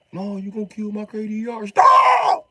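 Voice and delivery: put-on high shrill crying kid shout; loud high crying child yell; whiny kid yelling. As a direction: voice the son wailing stop. Son voiced crying shout.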